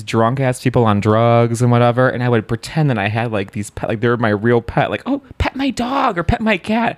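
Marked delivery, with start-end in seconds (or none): 5.0-7.0 put-on high kid voice. Acting out a childhood party line.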